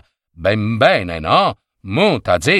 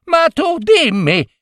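very high-pitched, spluttering scolding; outraged yelling. A narrator's reading. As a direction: cry out at a foolish husband in disbelief. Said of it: "gridò Giorgina". (grida acute e indignate)

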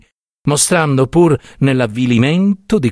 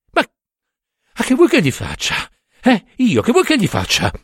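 very high, exasperated protest; acted fed-up outburst, pitched way up; 11 semitones higher than usual. A narrator's reading. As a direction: burst out in helpless exasperation. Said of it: "proruppe egli esasperato".

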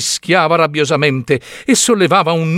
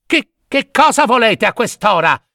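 irritated shout; 6 semitones higher than usual. A narrator's reading. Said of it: "il quale gridò, tutto stizzito".

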